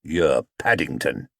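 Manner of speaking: low, slow verdict; grim. Acted. Detective naming the bear, low and grim. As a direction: deliver the name like a charge. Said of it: "he said grimly".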